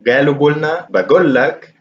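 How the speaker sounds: قالوا قلنا, بقول لك with a hard g for each qaf. Shown as a demonstration. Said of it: "it's kinda useful to use ga".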